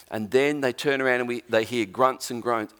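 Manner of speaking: ordinary talk, nothing marked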